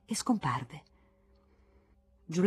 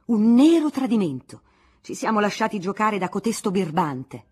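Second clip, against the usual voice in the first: loud, outraged shout; angry councillors clamouring over a betrayal; 2 semitones higher, 8 dB louder than usual. Acted. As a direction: yell it like a betrayed mob. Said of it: "vociferavano i più accaniti".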